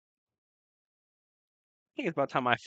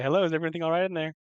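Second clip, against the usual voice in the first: higher, quick call to someone inside; calling through a door; 6 semitones higher, 4 dB louder, faster than usual. Acted. Player knocks and checks on the screamer, calling out.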